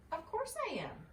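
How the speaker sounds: high, sharp irritated snap; snapping. A narrator's reading. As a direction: bite back proudly after the fall. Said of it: "snapped Mister Squirrel".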